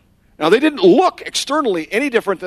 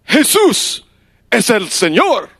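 ringing declaration, louder and higher; a converted soldier declaring his new faith; proclaiming; 6 semitones higher, 6 dB louder than usual. Retold or imitated. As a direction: announce it boldly.

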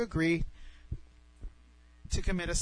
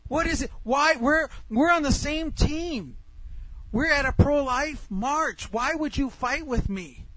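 high, slow, drawn-out pleading; acted-out incredulous arguing; exasperated protest; 7 semitones higher than usual. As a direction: protest in disbelief at an ally turning on you. Retold quarrel, exasperated pleading.

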